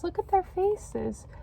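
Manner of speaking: normal talking voice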